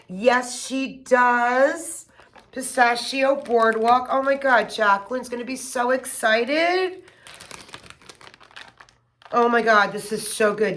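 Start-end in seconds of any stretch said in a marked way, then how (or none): none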